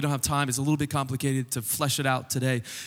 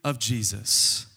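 emphatic preaching; 4 semitones higher than usual. Real, not acted. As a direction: proclaim it to the congregation, leaning hard on key words. Preaches the arrival of God's kingdom.

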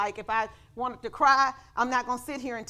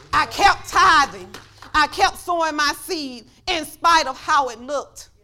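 slow preaching; 3 semitones higher, 7 dB louder, slower than usual. Acted. Testimony preached slowly, louder.

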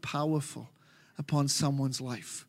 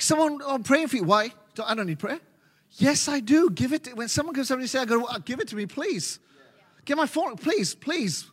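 anxious believer; 7 semitones higher than usual. Retold or imitated. Acting out suspicious congregant.

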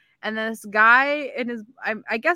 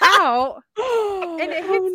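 shouted laughing; 9 semitones higher, 5 dB louder, slower than usual. Real, not acted. Guy spits water out.

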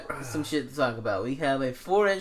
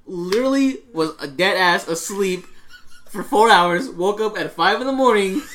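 normal talking voice

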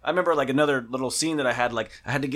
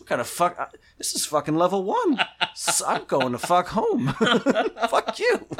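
laughing mock rant; 6 semitones higher than usual. Student mocking complaint, laughing.

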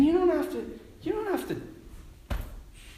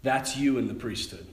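tender voice of God; 8 semitones lower, 5 dB quieter than usual. Retold or imitated. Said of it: "he's like".

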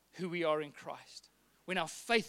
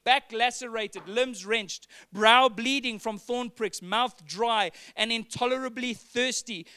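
rising, louder preaching read of the quote; impassioned quote reading; 2 semitones higher, 4 dB louder than usual. Acted. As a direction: build the quote with fervour. Reads John Stott quote passionately.

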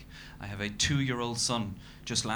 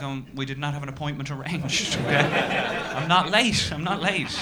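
higher, rising indignant protest; flustered defensive passenger; 4 semitones higher, 5 dB louder than usual. Retold or imitated. Passenger answering the angry cabbie, rising indignant protest. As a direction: defend yourself, getting higher and louder.

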